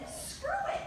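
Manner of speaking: high-pitched careless dismissal; mock giving up. Everybody in October quitting, mock careless dismissal.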